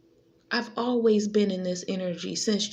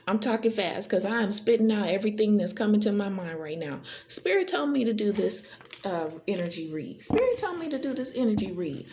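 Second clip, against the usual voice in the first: ordinary delivery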